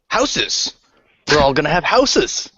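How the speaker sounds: high, gleeful in-character outburst; excited announcement. Acted. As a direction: blurt out the happy news with glee. Character blurts out happy news.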